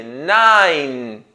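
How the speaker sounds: nine drawn out onto a final n; "nine" held on its closing n; ending on N. Shown as a demonstration. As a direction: say "nine" slowly with no vowel after the n. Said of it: "termina com o som de N".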